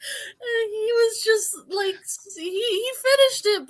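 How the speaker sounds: high-pitched, holding back laughter while telling it; fighting giggles to get the story out; squeezed, laughter-choked storytelling; stifled laughter. Real, not acted.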